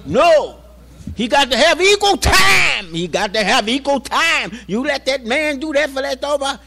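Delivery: high, loud shouting protest; a preacher's outraged cry for fairness; impassioned shout. Retold or imitated. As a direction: yell it out, jumping up to object. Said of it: "Kamaluddin jumped up".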